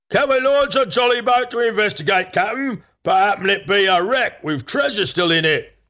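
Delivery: high-pitched, eager pirate sailor voice; eager crew yell. A narrator's reading. Crew asking the Captain to investigate, high eager yell. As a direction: plead high like a keen deckhand. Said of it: "yelled the crew".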